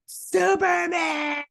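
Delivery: very high, long-held mock scream; cry for help. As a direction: shriek it out for a rescue, stretched. Acts character calling for rescue.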